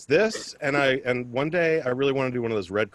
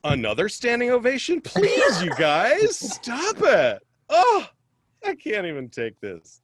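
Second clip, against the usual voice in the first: higher, louder fake-flattered protest; hammed-up sarcastic thanks for applause; mock modesty; 10 semitones higher, 5 dB louder than usual. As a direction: pretend the crowd is going wild. Sarcastic mock thanks to silent audience.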